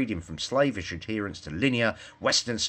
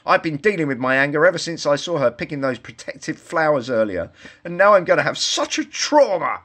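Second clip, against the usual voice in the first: higher, louder self-pitying woodchopper; whiny victim; 6 semitones higher, 5 dB louder than usual. A narrator's reading. Woodchopper complaining to the wolf, whiny. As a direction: whine about your trauma.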